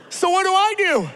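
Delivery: ordinary talk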